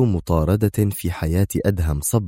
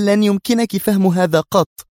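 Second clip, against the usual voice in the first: loud, high, edgy dismissal; irritated retort; 13 semitones higher, 5 dB louder than usual. A narrator's reading. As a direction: snap back nervously. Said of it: "أجابتها منى في عصبية". (بعصبية وانفعال)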